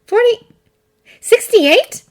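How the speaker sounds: high, sharp incredulous questions; sharp fairy. Acted. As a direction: snap the numbers back in disbelief. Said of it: "too sharp as ever".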